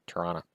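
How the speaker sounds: "Toronto" said as "Tronna", soft and quiet; quiet "Toronto", two syllables, "Tronna"; dad's Toronto. Shown as a demonstration. Tribute to his late father, saying "Tronna". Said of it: "a tip of the hat to, to my passed on father".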